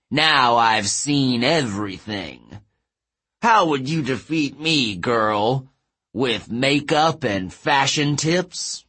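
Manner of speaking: big, drawn-out giant's taunt; mocking giant. Giant laughing at two demigod girls, mocking. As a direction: scoff slowly as a contemptuous giant.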